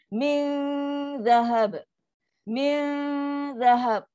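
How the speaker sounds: "Min" held long on a nasal hum, then "zahab"; slow recitation, wait on "Min" before touching "zahab"; settled dengung. Shown as a demonstration. Teacher demonstrates settling the hum before zal, held dengung. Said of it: "Settle dengung dulu baru sentuh huruf".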